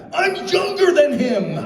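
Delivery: high, slow mock-offended protest; hammed-up indignant comeback; indignant retort. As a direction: fire it back, wounded pride. Billy shoots back indignantly.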